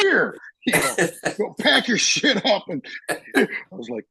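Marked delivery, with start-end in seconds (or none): 0.0-0.4 one high, sudden mock yell; imitated angry outburst. Angry chief shoving paperwork back. 1.4-2.6 high-pitched imitation of an irate chief; lightly acted dismissal from a superior; mock angry order. Retold order from screaming chief.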